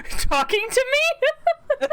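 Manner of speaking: high, slow girlish imitation; man doing a woman's voice. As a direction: mimic the pretty girl. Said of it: "it sounded exactly like the".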